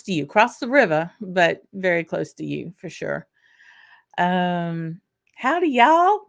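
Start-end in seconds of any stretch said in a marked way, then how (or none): 5.3-6.2 put-on high, loud, playful Scottish-accented greeting; mock Scottish accent. Host reads a chat greeting, mock Scottish accent. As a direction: put on a jokey Scottish accent, louder and higher. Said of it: "he said with a Scottish accent".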